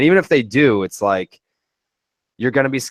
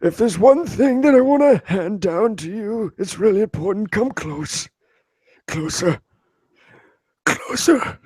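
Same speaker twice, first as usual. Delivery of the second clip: put-on old man voice; 10 semitones higher than usual. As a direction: play a feeble old man on his deathbed. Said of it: "Anytime you do the old man voice".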